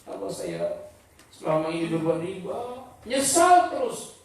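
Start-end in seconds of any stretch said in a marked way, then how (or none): 0.0-2.8 regretful lament. Acted. A repentant sinner realises he took riba, soft regret. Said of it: "Dia menyesal telah melakukannya".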